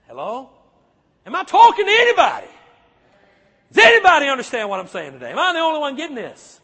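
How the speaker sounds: loud, fast, searching the room; exasperated preacher's call. Real, not acted. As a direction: demand a response from the pews. Calling out to congregation.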